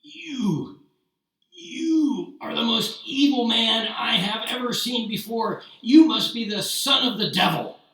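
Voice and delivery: high, loud, furious accusation; seething rage. Acted. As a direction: spit the insult out in fury. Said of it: "seething with anger".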